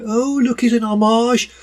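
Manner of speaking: high-pitched mock delight; gushing viewer. Imagined viewer spotting a film tribute, gushing in mock delight. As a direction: put on a high, impressed coo.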